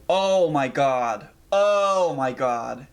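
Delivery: long, drawn-out distressed exclamation; freaking out. Real, not acted. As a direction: stretch it out slowly in real dismay. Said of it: "freaking out, man".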